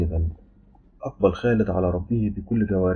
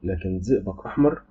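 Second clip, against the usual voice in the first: usual speaking voice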